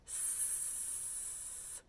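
"S" as a very soft, steady hiss; long hiss. Shown as a demonstration. Teacher confirming it stretches, soft sustained "S" hiss. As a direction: let "S" stream out long and quiet. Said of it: "can be stretched out long".

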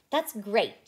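cheer. A narrator's reading. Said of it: "cheered Blue".

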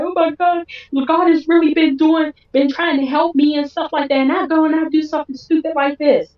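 high, mock-weepy lament; imitated wailing self-reproach, no real sobs; imitated tearful remorse. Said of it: "he just started crying".

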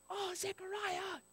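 high, very soft, drawn-out dismay; dismayed sigh. Acted. Preacher lamenting Zechariah's reaction, soft high dismay. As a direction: lament softly in a high, slow voice.

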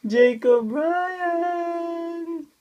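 very slow, long-held singsong call; playful drawn-out hail, rising in pitch. Pretend. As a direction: call the full name, stretching the last syllable out. Calling the baby's full name.

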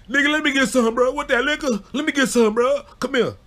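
high, loud pleading voice; eager Petey. Retold or imitated. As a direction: beg for the liquor. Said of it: "Here come P D ass".

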